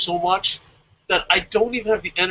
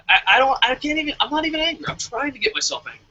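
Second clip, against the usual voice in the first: ordinary delivery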